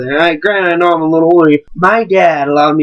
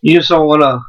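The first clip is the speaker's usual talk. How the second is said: normal talking voice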